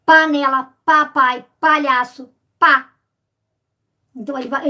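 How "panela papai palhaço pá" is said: panela, papai, palhaço, pá, each louder on the first syllable pa; alliteration stressed. Shown as a demonstration. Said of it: "Como a literação". (aliteração com pa marcado em panela, papai, palhaço, pá)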